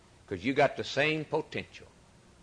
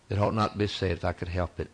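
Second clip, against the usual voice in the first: normal talking voice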